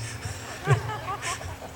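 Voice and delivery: soft, high chuckle; light real laugh, trailing on. Real, not acted. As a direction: laugh quietly at your own story. Laughs at own knitting remark.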